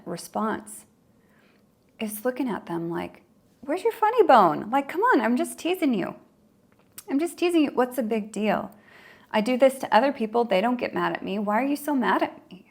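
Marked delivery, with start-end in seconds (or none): 3.6-6.1 high, dismissive ribbing; teasing. Acted. Teaser mocking the startled person. 7.1-7.7 higher, pushier teasing. Acted. Teasing line repeated, higher. 7.7-12.6 high, dismissive ribbing; teasing. Acted. Teaser mocking the startled person.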